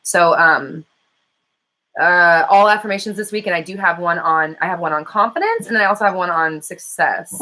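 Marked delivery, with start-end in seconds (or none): none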